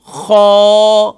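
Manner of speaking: خو with the vowel held open and long, no stop at the end; tajweed demo. Shown as a demonstration. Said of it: "second word ہے خو".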